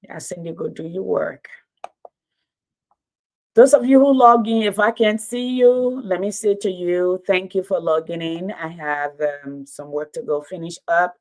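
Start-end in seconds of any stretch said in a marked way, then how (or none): 3.5-7.8 loud, higher call to the viewers. Real, not acted. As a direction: reach the people far back. Calling out to viewers.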